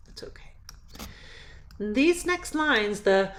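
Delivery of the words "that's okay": soft whispered reassurance; gentle whispered aside. Real, not acted. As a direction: breathe it, barely voiced. Reassuring student about voice change.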